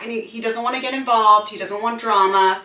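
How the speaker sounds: normal talking voice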